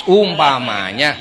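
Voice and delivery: normal talking voice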